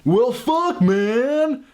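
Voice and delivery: high-pitched, drawn-out impersonation; Bill Paxton impression. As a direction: pitch it way up and stretch out the words. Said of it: "here's my impression of the man".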